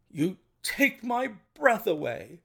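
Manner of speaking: high-pitched, amazed exclamation; astonished. A narrator's reading. Colonel learning his horse was disguised, astonished. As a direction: say it stunned by the reveal.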